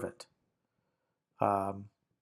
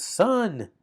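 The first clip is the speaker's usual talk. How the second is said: raised, slow call to someone, as if addressing them; calling a son by name; 10 semitones higher, 7 dB louder than usual. Shown as a demonstration. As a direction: call "son" out to him, drawn out and falling. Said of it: "like direct address".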